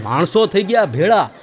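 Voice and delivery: usual speaking voice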